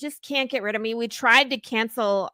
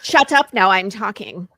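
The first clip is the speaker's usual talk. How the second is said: bossy anger; 7 dB louder than usual. Acted. Host silencing her co-host, bossy and angry. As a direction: shut him down hard.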